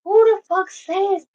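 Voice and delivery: high, loud disbelief; who says that, in shock; incredulous. Real, not acted. Reacting to a quoted excuse, a high loud incredulous cry.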